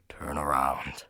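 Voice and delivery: unvoiced, low creepy whisper; eerie whisper. The note warns the narrator, creepy whisper. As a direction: breathe the words like a threat.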